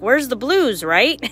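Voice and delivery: normal talking voice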